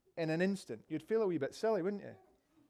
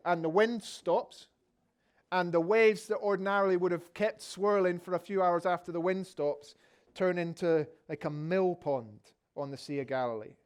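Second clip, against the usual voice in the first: usual speaking voice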